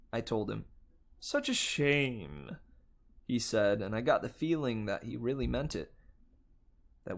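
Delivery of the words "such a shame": slow, oily hotel host voice; smooth bellhop. Acted. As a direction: sell the offer sweetly. Bellhop sorry to see them go.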